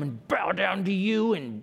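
hammed-up growling threat; raspy mock menace. Shown as a demonstration. Raspy mock-menace reading continues.

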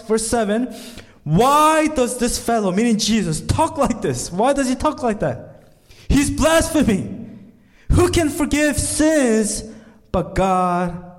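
high, loud preaching of the scribes' doubt; rising sermon climax. Acted. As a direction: build the scribes' outrage from the pulpit. Preaches the Pharisees' words.

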